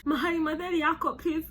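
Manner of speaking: high, fast, laughing mock mother's plea; mother pleading. Mother begging the government to take him, high mock plea. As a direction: imitate a frantic mother, breaking into laughs. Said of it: "his mom says".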